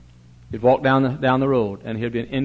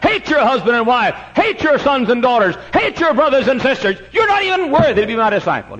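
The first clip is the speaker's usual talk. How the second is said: louder, higher preached demand; thundering demand; 10 semitones higher, 4 dB louder than usual. Acted. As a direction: hammer each hate line home. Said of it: "he said".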